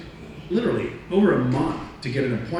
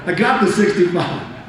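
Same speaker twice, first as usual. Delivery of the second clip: gleeful brag; 6 semitones higher, 7 dB louder than usual. Real, not acted. Triumphant about salary.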